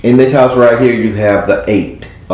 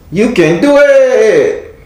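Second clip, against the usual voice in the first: high, slow, hammed-up Mexican voice; Mexican accent; 13 semitones higher, much slower than usual. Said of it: "in my Mexican voice".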